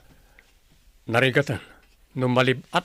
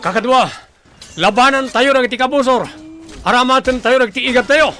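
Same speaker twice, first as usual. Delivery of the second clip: much higher, louder call to arms; battle cry; 12 semitones higher, 10 dB louder than usual. Acted. Warrior urges comrades to use their arrows, loud battle cry. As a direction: shout the charge to comrades.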